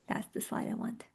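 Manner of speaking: whispered under her breath; soft whisper to herself as she finds the slide; whispered aside. Real, not acted. Presenter whispering to herself at the slide.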